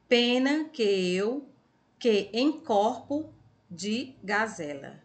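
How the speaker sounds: slow, deliberate poem reading aloud; reading to children. Acted. Teacher reading a playful animal poem to pupils, reading aloud. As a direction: read it to the class, stretching each phrase.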